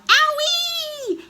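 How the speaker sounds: loud, drawn-out shriek; high pained yell. A narrator's reading. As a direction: shriek as if scalded. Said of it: "Grandma yelled".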